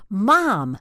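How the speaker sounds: short, terse, loud "Mom!" pitched high; outraged call. Shown as a demonstration. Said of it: "There's outraged".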